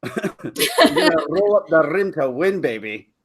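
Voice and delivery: slow, playful Scottish trill; Scottish rolled R's. Pretend. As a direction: roll the R's in mock Scottish. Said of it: "you really gotta roll your R's".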